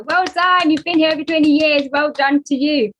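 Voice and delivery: loud, high, hammed-up well done; mock ceremony congratulations. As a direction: boom out the praise like a presenter at a ceremony. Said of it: "They go".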